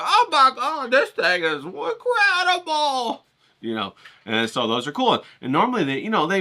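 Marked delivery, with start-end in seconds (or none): 0.0-3.2 high, slow, gushing wonder; mock amazement. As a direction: swoon over a giant art book. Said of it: "you get them like".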